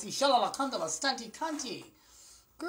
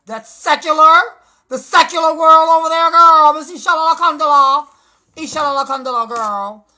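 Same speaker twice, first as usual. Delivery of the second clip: loud preacher-style hollering; full-volume shout; 7 semitones higher, 19 dB louder than usual. Real, not acted. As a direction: belt it out like calling from the pulpit. Excited preaching-style rant.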